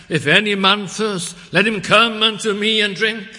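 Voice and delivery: loud, high proclamation; crying out. Retold or imitated. Jesus stands and invites the thirsty crowd, crying out. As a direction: call it out over a whole crowd. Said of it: "and he cries out".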